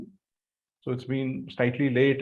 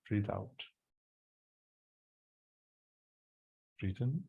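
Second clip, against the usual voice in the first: soft, hushed breathing cues; quiet meditation guide; guided breathing; 4 semitones lower, 9 dB quieter than usual. Acted. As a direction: ease them through each breath, softly.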